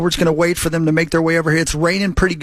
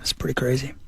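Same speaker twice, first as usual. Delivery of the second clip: normal talking voice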